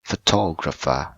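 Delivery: "photographer" with the weight on "tog"; stress on second syllable. Shown as a demonstration. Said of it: "On the second syllable".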